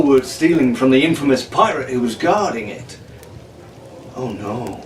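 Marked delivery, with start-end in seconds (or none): 4.1-4.8 soft, hushed gasp of dismay; whispered. A narrator's reading. Arabella, watching the sack, whispers softly. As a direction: breathe it out under your breath. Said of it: "Arabella whispered".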